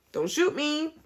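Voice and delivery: high, jokey plea for mercy; mock pleading squeak. Vlogger jokingly begging viewers not to shoot her, high mock plea.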